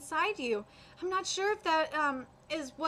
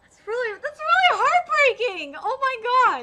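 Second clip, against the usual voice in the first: high, loud, slow wail fighting back tears; heartbroken outcry; 9 semitones higher, 11 dB louder than usual. Real, not acted. Player fighting tears, crying out loud over the sad scene. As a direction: let it burst out, close to crying. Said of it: "I'm trying not to cry".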